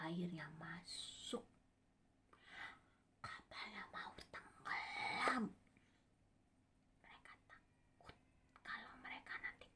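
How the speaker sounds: breath-only, very soft; hushed storyteller's dread; suspenseful whisper. A narrator's reading.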